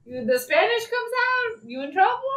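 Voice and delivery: high-pitched playful warning, rising at the end; =tease that someone is busted; hammed-up, high you're-in-for-it delivery; mock warning.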